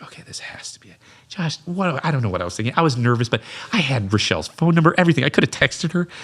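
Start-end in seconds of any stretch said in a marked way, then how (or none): 0.0-1.0 a soft unvoiced whisper to himself; whispered to self. Alone upstairs before a blind date, whispering to himself. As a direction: whisper it nervously under your breath.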